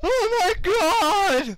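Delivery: high, slow shocked scream; startled shriek. Acted. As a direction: scream it like something just ran over your foot. Startled by a rat in the building.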